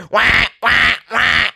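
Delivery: high, loud repeated alarm blare; imitated smoke detector. Reactor mocking someone loud, smoke detector imitation. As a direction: mimic a blaring smoke alarm. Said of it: "you sound like a smoke detector".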